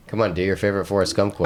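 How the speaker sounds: ordinary delivery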